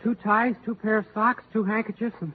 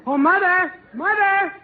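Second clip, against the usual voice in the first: high, loud call for mother; 10 semitones higher, 6 dB louder, slower than usual. Acted. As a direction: shout it across the house. Son calling out for mother.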